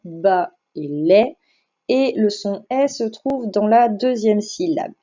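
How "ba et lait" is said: slow, separated ba and lait; syllable by syllable. Shown as a demonstration. Teacher cutting balai for pupils, slow syllable by syllable. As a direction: split the word into ba, then lait, slowly. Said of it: "on a deux syllabes".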